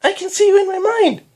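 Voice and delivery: put-on very high, loud amazed child squeal. A narrator's reading. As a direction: squeal with wonder as a little girl. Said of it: "she squealed".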